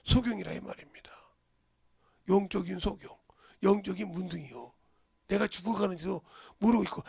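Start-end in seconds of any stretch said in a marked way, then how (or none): none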